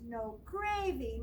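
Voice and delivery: plain reading voice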